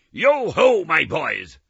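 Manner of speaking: loud, high, hearty call; a jolly master rallying his boys; jovial Fezziwig. Acted. Fezziwig to his apprentices, loud and jovial. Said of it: "said Fezziwig".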